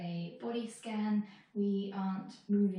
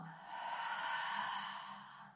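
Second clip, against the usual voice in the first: long, airy exhale through the mouth; long sigh out; 6 dB quieter than usual. Shown as a demonstration. Teacher showing how to exhale out the mouth, long sigh. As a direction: sigh the whole breath out. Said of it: "sigh it out".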